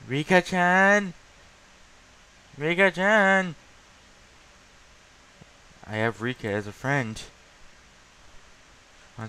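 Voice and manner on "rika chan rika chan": excited call. Real, not acted. Excitedly calling a character's name.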